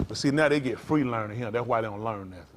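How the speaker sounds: ordinary talk, nothing marked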